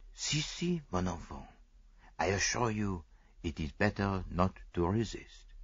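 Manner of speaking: put-on calm her quietly like a child; Poirot soothing the frightened maid, soft; soft reassurance.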